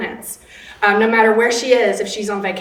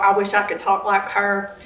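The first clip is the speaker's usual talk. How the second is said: ordinary talk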